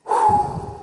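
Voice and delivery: long exasperated sigh; weary sigh. Real, not acted. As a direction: let out a fed-up sigh. Fed-up pause in a rant.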